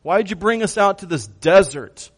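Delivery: loud, high-pitched mock complaint; complaining and grumbling. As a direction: gripe loudly like a fed-up crowd. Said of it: "start to complain and grumble".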